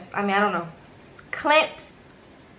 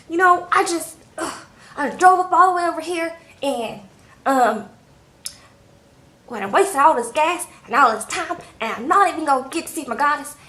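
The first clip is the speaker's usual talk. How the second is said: mock frustrated rant; 6 semitones higher, 4 dB louder than usual. Clint goes crazy after phone call.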